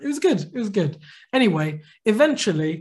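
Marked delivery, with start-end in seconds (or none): 0.0-0.4 pitched high and pleased; high and gleeful; proud high relish. Real, not acted. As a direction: savour it with a lift. Savouring the moment, then repeating.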